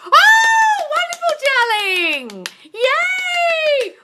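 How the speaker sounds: very high, shouted, long-held squeal; ecstatic cheer. Real, not acted. Coach cheering a client who is getting their manifestations, ecstatic cheer. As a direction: shriek with joy, stretching the words right out.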